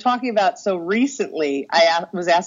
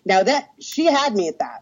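normal talking voice

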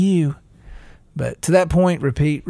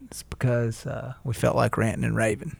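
trailing off; 3 semitones lower, 7 dB quieter than usual. Real, not acted. Wraps up rant, voice drops.

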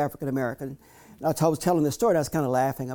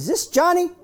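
put-on higher, louder voice of an old Southern woman; old maid's voice; 7 dB louder than usual. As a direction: put on the maid asking on the phone. Maid voiced on the phone.

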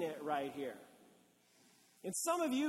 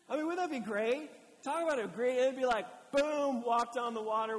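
excited; 4 semitones higher, 7 dB louder than usual. Acted. Pastor imagines Peter's triumph.

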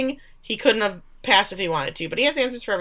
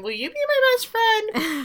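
creepy needy Misty. Retold or imitated. Retelling Misty's creepy friendship plea.

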